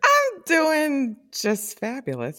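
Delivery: high, slow, drawn-out diva reply; mock fabulous. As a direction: lay on the glamour. Mock posh reply to sweetheart.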